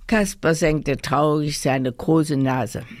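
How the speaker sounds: plain reading voice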